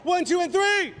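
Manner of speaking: loud, high count to three; rally the crowd on three; countdown shout. Real, not acted.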